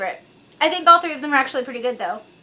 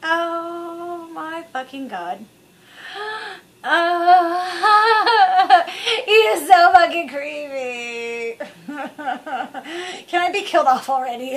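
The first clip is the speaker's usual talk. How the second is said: slow, laughing, horrified mock whine; disgusted Natalie Portman; 4 semitones higher, much slower than usual. Natalie Portman stuck with the creep, slow horrified laughing whine. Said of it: "Natalie Portman, was she ever just sitting there going like".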